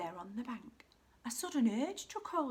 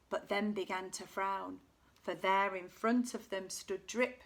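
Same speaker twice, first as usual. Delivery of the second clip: ordinary delivery, nothing marked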